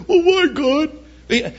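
high, slow drawn-out whine; sniveling whine. Retold or imitated. A sniveler whining to God, high and drawn out. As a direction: snivel it out slowly. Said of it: "sniveling and saying".

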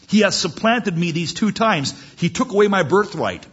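high, loud acted grievance; indignant protest. Cheated brother protesting to his father, loud indignation.

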